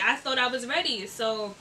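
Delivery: ordinary delivery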